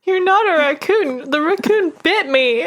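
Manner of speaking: high upset outburst; protest, hurt and high; upset cry. Pretend. Betrayed by raccoon Leo.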